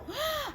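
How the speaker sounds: sharp high gasp of alarm; storytelling gasp; dramatic gasp. Acted. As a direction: gasp for the little listeners. Shadow seen, ghost fear.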